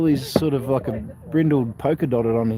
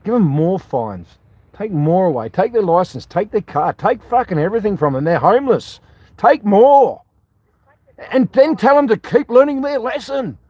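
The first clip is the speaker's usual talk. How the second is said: rising, scornful mock outrage, louder and higher by the end; sarcastic rant; 7 semitones higher, 5 dB louder than usual. Real, not acted. Ranting sarcastically at the cops' treatment of the homeless. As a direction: heap on the sarcasm as it builds.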